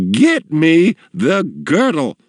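high, slow grudging command; demanding matron, drawn out; imperious customer. A narrator's reading. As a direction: order him haughtily, word by word. Customer gives in, orders father.

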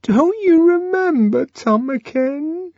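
very high, quavering plea; trembling voice. A narrator's reading. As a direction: let the voice shake as you ask. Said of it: "in a voice that trembled".

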